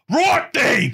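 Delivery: high shouted Brummie catchphrase; Brummie accent. Acted. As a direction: burst out in a broad Brummie yell. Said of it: "he would go".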